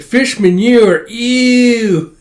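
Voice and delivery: high, loud, slow disgusted voice; grossed out. Acted. Bond hearing about dinner, high and loud disgust. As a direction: recoil at the thought of fish manure. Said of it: "It's like".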